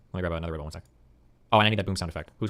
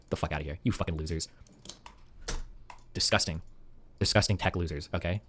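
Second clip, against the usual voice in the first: low, soft muttered contempt; quiet disgust; 7 semitones lower, 13 dB quieter than usual. Real, not acted. Disgusted muttering after mock quote.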